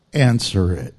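a very low, loud, commanding "Answer it"; deep voice of the Lord. Acted. The Lord commanding a tired believer, low and loud. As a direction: drop far down and command it like God. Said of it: "And the Lord says".

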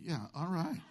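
normal talking voice

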